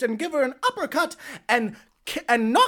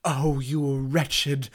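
scornful grande dame; 8 semitones lower, 4 dB quieter, much slower than usual. Acted. Ex-wife scorns intruder.

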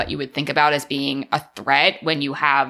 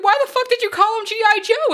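high, mock-outraged demand; squeaky mock disbelief; incredulous. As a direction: play someone who can't believe what they heard. Retelling the argument.

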